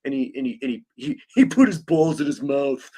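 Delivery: dumb snickering teenager impression; Beavis and Butthead. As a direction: stumble along like a giggling dimwit teen. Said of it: "it was like Beavis and Butthead".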